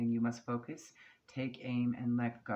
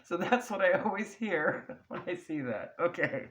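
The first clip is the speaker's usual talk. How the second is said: laughing; 6 semitones higher, 6 dB louder than usual. Real, not acted. Still laughing after the impression.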